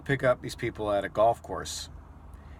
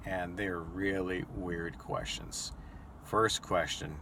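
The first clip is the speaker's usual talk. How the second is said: usual speaking voice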